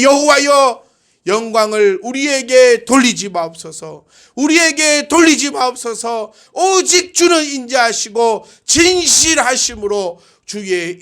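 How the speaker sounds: high, forceful cry to God. Acted. Preacher crying a psalm to God, forceful and high. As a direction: proclaim the prayer, stretching key words. Recites psalm prayer aloud, first take.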